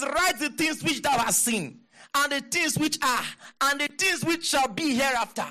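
high, emphatic declamation; fervent scripture reading. Real, not acted. Preacher reading the command to the congregation, high emphatic voice.

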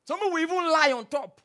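high, loud outcry; preaching shout. Real, not acted. Preacher to congregation, loud high outcry. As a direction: call it out from the pulpit.

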